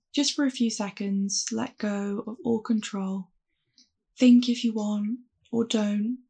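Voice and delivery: calm, unhurried meditation voice; guided meditation. Acted. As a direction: guide them gently through the breath. Leads a mindfulness meditation.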